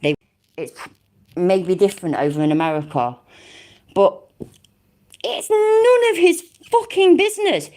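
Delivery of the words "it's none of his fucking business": much higher, indignant burst; furious, voice jumping way up; outraged. Real, not acted. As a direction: spit out the outrage. Outraged at police arrest.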